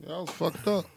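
usual speaking voice

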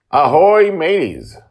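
high, loud pirate hail across the deck; hammed-up pirate call to the listeners; pirate greeting. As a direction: sing out ahoy like a jolly pirate captain.

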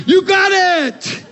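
excited exclamation. Real, not acted. Preacher exclaims the revelation.

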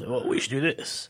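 lightly put-on hero voice; Star-Lord copying a voice. Captain copying the newcomer's voice, mildly mock-deep. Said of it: "So he starts copying his voice".

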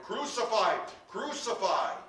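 loud, high, urgent shout. A narrator's reading. Priests and officials see Jesus, shouting. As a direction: shout it as a baying crowd. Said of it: "they shouted".

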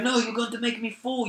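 hammed-up high screamy protest; retold panicked screaming. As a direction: imitate her frightened shriek. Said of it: "she started screaming, like, just absolutely batshit screaming".

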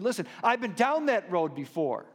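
loud, exasperated voice of someone let down by God; sarcastic complaint about God, voiced loud and high; frustrated doubter. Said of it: "some of us don't ask because we said".

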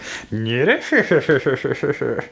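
sly cartoon cackle in a long run; Koro Sensei. Imitating a manga villain's grin for the co-host, smug snicker. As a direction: mimic a smug anime snicker. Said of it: "it's very Koro Sensei".

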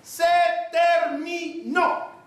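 mock shout. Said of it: "gritó así a la mujer".

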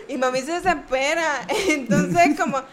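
usual speaking voice

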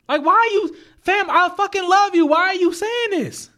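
retelling his hurt reply to her, high and pained; hurt outburst. Retold or imitated.

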